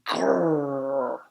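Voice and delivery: long rolling "grrr" growl; tiger growl. A narrator's reading. Storyteller imitating the horrible noise, growling. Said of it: "horrible noise that sounded like".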